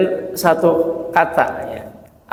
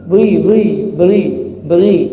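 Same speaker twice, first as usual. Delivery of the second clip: put-on Sumatran Malay accent; 2 semitones higher than usual. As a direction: imitate a Sumatran Malay saying "beri". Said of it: "Itu kalau ngomong". ("beri" dengan logat Melayu Sumatera)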